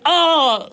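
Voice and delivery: a high retold ouch; retold pained yelp. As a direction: yelp as if your eye was just poked. Eye poked, retold pain.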